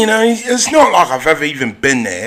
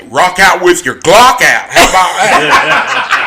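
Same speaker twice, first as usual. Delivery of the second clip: loud retort; 2 semitones higher, 6 dB louder, slower than usual. Acted. Acted defiance in Southern talk.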